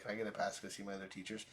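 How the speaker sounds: quick, soft, offhand request; nonchalant. Retold or imitated. Student asking for a pass so nonchalantly, offhand. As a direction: toss it off casually and quietly. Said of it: "so nonchalantly".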